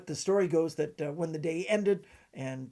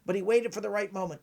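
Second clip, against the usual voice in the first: higher, louder impersonation of the veteran magician; Vernon; 6 semitones higher, 5 dB louder than usual. Acted. Magician praising a colleague to the audience, in impersonated voice. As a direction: voice pitched up, telling it as the famous professor. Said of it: "this is my Vernon impression".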